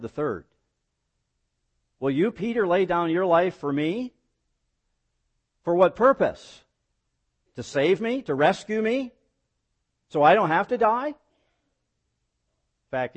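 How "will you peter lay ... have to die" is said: high, loud string of rising questions; incredulous challenge. Acted. Preacher as Jesus questioning Peter's promise, incredulous and raised. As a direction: press each question on him in disbelief.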